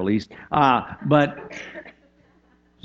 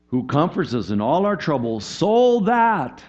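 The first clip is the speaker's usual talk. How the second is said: preaching; 6 semitones higher, 4 dB louder, slower than usual. Acted. Reads scripture with rising force.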